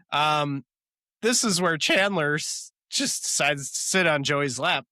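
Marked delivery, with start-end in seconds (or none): none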